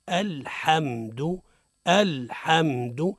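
الحمد slowly, each letter distinct, short final "du"; repeat-after-me demonstration. Shown as a demonstration. Teacher asks older listeners to repeat, slow model pronunciation. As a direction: draw out الحمد carefully for learners to copy. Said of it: "يعاودوا معانا".

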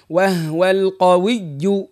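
slow وهو القوي with doubled و in وهو; slow teacher demo of the waw shaddah. Shown as a demonstration. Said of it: "اسمعني مليح".

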